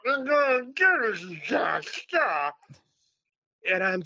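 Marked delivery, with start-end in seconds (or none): 0.1-2.5 put-on slow, high, sloppy drunk threat; drunk boast. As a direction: draw it out like a tanked guest. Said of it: "getting more and more tanked".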